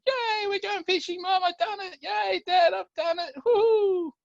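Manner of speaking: put-on high, loud child's whoop of victory; triumphant little boy. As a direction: burst in cheering like a kid who did it. Boy triumphant, runs to dad.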